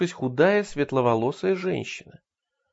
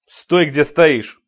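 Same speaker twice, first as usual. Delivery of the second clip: slow, loud command; stern shout; 3 semitones higher, 10 dB louder, much slower than usual. Acted. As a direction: order him to stay put. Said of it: "строго крикнул он".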